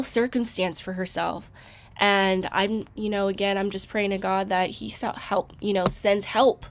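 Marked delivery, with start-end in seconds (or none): none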